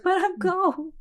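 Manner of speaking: whiny complaint. Retold or imitated. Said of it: "And I was like".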